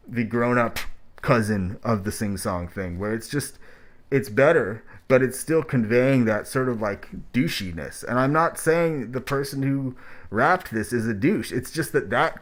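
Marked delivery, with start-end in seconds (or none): none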